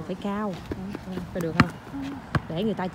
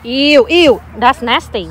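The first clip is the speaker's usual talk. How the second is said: loud, disgusted ew; loud grossed-out exclamation; 3 semitones higher, 13 dB louder, much slower than usual. Real, not acted. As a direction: recoil from something filthy out loud. Mother reacting to something dirty.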